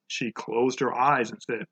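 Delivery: plain reading voice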